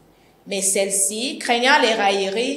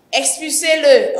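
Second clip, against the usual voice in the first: ordre sec; 5 semitones higher, 6 dB louder than usual. Acted. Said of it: "ordonna-t-elle".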